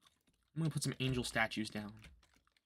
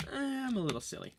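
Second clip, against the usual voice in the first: squeaky high silly voice; 11 semitones higher than usual. Pretend. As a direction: say it in a goofy high voice. Silly voice after laughing.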